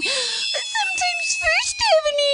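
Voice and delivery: high squeaky toy voice. Acted. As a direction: squeak out each avenue. Silly high voice listing avenues.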